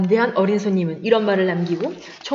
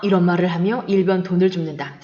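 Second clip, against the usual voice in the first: ordinary delivery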